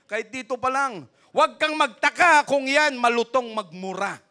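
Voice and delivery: loud, high preaching shout; rising to a loud pulpit shout. Real, not acted. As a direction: hammer the warning home to the congregation. Preacher warning parents about cursing.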